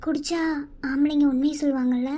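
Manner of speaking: low, soft thinking aloud; inner thoughts. Acted. Wife wondering what her husband hides, low and soft.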